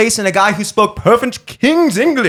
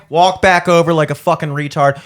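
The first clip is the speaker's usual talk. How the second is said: ordinary talk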